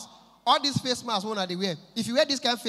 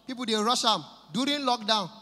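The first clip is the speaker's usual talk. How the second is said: usual speaking voice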